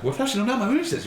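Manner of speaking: high, fast, eager learner's Japanese; all excited. Retold or imitated. Imitating a learner proudly introducing themselves in Japanese, excited high chatter. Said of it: "You're like, all excited".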